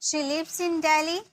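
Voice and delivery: slow, clear dictation; slow model sentence. Shown as a demonstration. Teacher dictating the translation slowly. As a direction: stretch it for students to follow. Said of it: "इसके लिए बोलेंगे".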